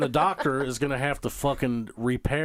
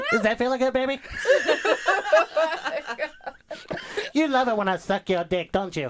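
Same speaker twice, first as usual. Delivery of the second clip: mock seductive; 11 semitones higher than usual. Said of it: "like, like".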